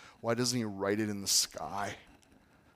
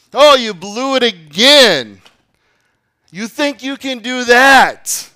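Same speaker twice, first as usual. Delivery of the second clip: much higher, louder accusing yell; devil's mocking shout; 10 semitones higher, 20 dB louder, slower than usual. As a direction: sneer and scream like the accuser. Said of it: "lies that he loves to shout".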